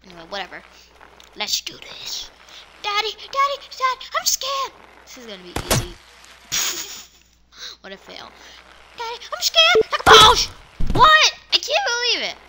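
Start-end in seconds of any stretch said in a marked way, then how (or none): 2.8-4.7 put-on high little-kid whimper; child calling to his dad in fear; scared kid voice. 9.0-10.5 put-on high little-kid whimper; child calling to his dad in fear; scared kid voice.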